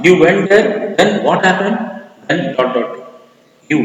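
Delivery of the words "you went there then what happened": surprised partner. Acted.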